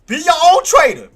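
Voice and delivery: high, loud mock-outraged telling-off; loud scolding. Real, not acted. Trader to buyers copying his moves, loud scolding.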